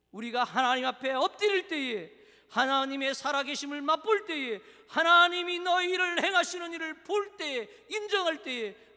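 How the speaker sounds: building, loud rhetorical climax; full-force preaching. Real, not acted. Preacher urging congregation to bow before God, building shout.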